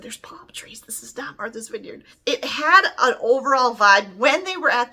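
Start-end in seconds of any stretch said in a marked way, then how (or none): 0.0-0.8 hushed whisper to a friend in the cinema; whispered aside. Retold or imitated. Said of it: "I said". 0.8-2.0 hushed, secretive whisper; whispered aside. Retold or imitated. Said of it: "I said".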